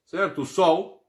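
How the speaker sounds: ordinary delivery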